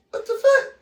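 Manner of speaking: imitating husband's stunned reaction; shocked. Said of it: "He like".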